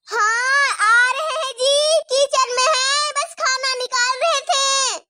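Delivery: high, loud, slow, crying answer; tearful frightened reply. Acted. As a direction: cry out that you're coming, scared. Scared wife answers angry husband. (डरी हुई बहू, रोती हुई ऊँची आवाज़ में जवाब)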